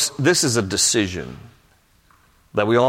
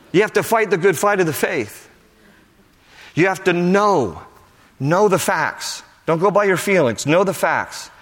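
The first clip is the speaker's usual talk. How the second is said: raised-pitch, emphatic preaching; 6 semitones higher than usual. Real, not acted. Preacher telling believers to know the facts, emphatic preaching. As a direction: urge the congregation with conviction.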